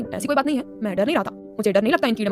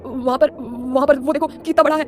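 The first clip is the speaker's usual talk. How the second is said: loud, panicked pointing-out; scared yelling; 2 semitones higher, 4 dB louder than usual. A narrator's reading. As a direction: cry out in cockroach terror, voice raised. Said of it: "जब चिल्ला रहा था".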